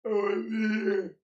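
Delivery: high, slow drawn-out O M G; drawn-out OMG. Pretend. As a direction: stretch it out in mock disbelief. Streamer reacts in mock shock.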